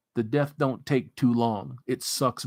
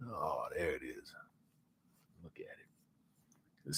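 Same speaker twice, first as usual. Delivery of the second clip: much lower and softer, reverent; hushed awe; 10 semitones lower, 18 dB quieter, faster than usual. Real, not acted. Streamer admiring the food, low hushed awe. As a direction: breathe it out in awe.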